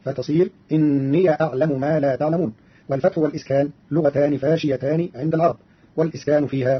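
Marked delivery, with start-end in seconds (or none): 0.7-2.5 إني said inniya with the ya opened; Qalun's fatha on the ya of إني. Shown as a demonstration. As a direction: open the ya of إني into inniya before أعلم. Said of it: "بفتح ياء الإضافة".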